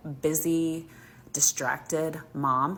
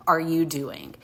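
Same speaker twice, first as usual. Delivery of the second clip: firm challenge; much slower than usual. Retold or imitated. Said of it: "as loud as I can".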